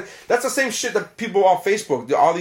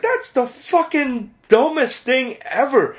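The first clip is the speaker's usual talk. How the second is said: high, slow disbelieving scoff; mock the idea in your head; inner scoff; 9 semitones higher, slower than usual. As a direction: private sneer, pitched way up and slowed. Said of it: "But in my mind, I was like".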